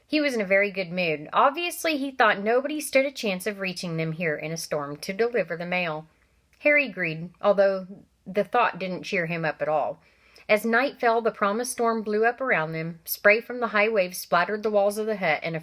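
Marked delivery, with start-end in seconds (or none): none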